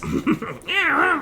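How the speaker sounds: put-on high cackle in an old lady voice. Player warms up the old lady voice with a high cackle. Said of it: "I get to do an old lady voice".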